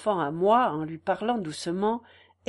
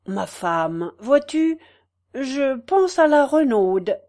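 louder and slow, weighing each word; slow articulated farmer; 3 semitones higher, 6 dB louder, slower than usual. Acted. Farmer telling his wife his idea, slow and louder. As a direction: spell it out like a slow peasant. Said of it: "il articula".

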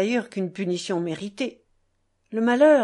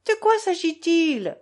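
put-on high, loud sudden girl's question; exclaiming; 8 semitones higher, 4 dB louder than usual. A narrator's reading. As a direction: blurt it out, alarmed and curious. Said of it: "s'exclama Lise". (exclamation vive et aiguë)

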